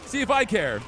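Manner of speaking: loud, high mock-indignant taunting; hammed-up boss challenge. Player taunting Lubu in a one-on-one, hammed-up shouting.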